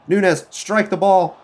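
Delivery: real furious shout, pitch way up; furious outburst. Real, not acted. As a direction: scold him for backing off, voice cracking up high. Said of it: "I'm, I'm furious here".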